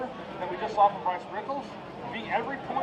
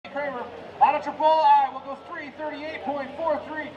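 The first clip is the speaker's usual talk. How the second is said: loud, high announcer call; hyping the crowd; 6 semitones higher, 5 dB louder than usual. Real, not acted. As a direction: pump up the crowd.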